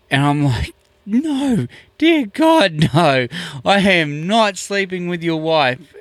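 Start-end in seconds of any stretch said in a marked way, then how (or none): 1.0-5.9 high-pitched, panicky mock protest; hammed-up horrified denial; alarmed denial. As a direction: plead innocence, voice jumping way up. Acting out his alarmed denial.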